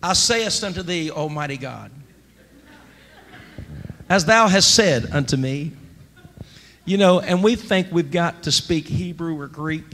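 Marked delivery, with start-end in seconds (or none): 0.0-5.7 lightly put-on old-fashioned formal prayer voice; a hint of a lofty King James prayer; mock King James prayer. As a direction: pray in stiff olde English. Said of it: "speak to the Lord in the King James Version".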